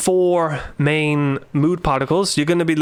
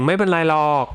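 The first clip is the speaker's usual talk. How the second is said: dragged out; much faster than usual. Shown as a demonstration. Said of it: "drag that out a little bit".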